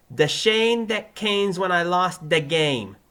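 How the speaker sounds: high, loud Brooklyn-accented attempt; Joey's Brooklyn accent. Pupil copying his teacher, thick Brooklyn accent.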